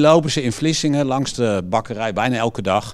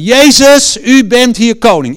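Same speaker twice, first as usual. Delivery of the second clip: loud proclamation; 9 semitones higher, 11 dB louder than usual. Retold or imitated. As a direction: shout it out boldly, high and loud. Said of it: "Dus niet zo".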